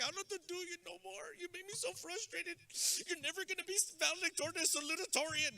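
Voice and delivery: high, whiny fake-crying mom voice; mock tearful parent. Parent frustrated to tears at their kid, mock tearful wail. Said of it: "they start crying before you do".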